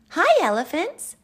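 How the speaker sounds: very high, loud little Albie; eager greeting. Acted. Albie hailing the cheetahs, high eager call. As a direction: call out brightly as an excited child.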